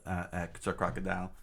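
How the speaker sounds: usual speaking voice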